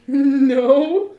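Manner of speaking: long, drawn-out loud no; loud, lingering cry of disbelief; horrified disbelief. Real, not acted. Mock horror at the movie.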